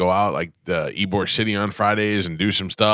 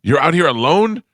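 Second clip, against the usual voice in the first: higher and louder, amazed question; astonished fan; 9 semitones higher, 5 dB louder than usual. Retold or imitated. Fan asking Mel Gibson about security, astonished higher voice. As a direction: gush the question like a shocked fan. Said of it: "he's like".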